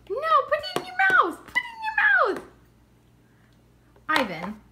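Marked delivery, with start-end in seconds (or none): 0.1-2.4 high-pitched urging. Real, not acted. Urging child to eat.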